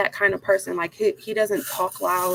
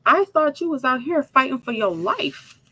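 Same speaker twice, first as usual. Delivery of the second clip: high-pitched retold protest; mock indignation; 7 semitones higher than usual. Wife confronting husband with a bat, mock indignation. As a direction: protest in exasperation, pitch climbing.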